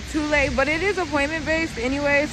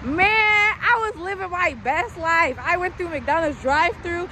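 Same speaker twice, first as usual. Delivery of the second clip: real delight remembering her first drive-thru; gleeful; 6 semitones higher, slower than usual. Real, not acted. Gushing memory.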